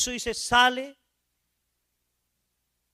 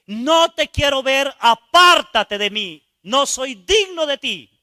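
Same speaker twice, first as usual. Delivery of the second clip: loud, higher, slow desperate shout; 4 semitones higher, 10 dB louder, slower than usual. Retold or imitated. The dead youth shouting at the Son to go away. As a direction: scream your unworthiness at him. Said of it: "y le grité".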